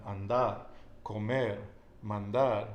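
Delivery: "Andar, comer, mandar" each punched on the last syllable; final-syllable stress. Shown as a demonstration. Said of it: "At the end".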